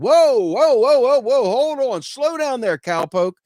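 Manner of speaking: high, loud mock-panicked stop; hammed-up cowboy hold on; loud, high mock alarm; loud panicked whoa. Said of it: "they're like".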